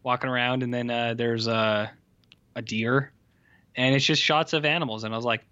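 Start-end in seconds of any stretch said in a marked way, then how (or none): none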